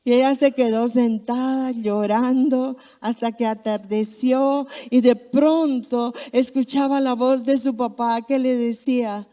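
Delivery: ordinary talk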